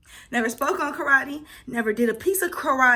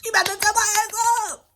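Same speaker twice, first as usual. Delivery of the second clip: high, loud cry of dread; scared kid crying out, much higher and louder; panicked cry; 10 semitones higher, 3 dB louder, slower than usual. Retold or imitated. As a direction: wail it like the whooping is coming. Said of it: "Oh, I was like".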